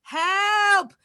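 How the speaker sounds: high, loud pretend calls for help; hammed-up yelling for rescue; mock cry for help. Trapped at home, calling out to the audience in a mock cry for help.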